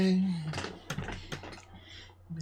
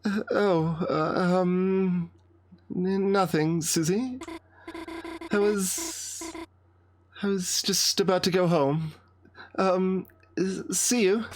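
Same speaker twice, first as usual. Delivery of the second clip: put-on higher, shy stammering girl voice; lightly voiced flustered Noelle, higher; nervous stammer; 6 semitones higher, slower than usual. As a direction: stutter it out timidly like caught sneaking. Reading Noelle's nervous dialogue.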